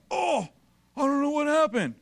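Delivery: high-pitched put-on dismay; mock bewilderment. Preacher mimicking someone surprised by the harvest, mock bewilderment. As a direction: wail it lightly, as if caught unawares. Voices a baffled backslider.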